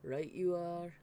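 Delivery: West Country baker, Hot Fuzz style; broad Hot Fuzz country accent. Acted. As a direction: rural English village twang on Right you are. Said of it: "That accent".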